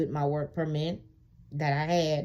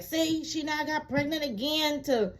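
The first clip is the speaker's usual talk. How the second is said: high sneering voice of the officer; mocking officer; 10 semitones higher than usual. Imitating the immigration officer, a high sneer. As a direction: mimic the officer sneering at her pregnancy. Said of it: "she's like".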